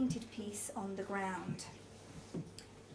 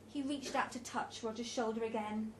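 ordinary delivery, nothing marked

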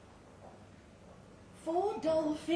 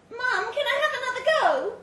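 put-on high, loud, eager child; a small girl excitedly calling her mum; little girl's voice; 11 semitones higher, 8 dB louder than usual. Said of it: "a little girl's voice".

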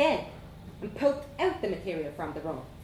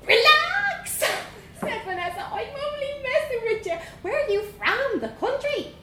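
put-on high, bold Dublin girl voice; young Dublin girl; 4 dB louder than usual. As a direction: play a cheeky little Dublin girl. Vanessa teasing.

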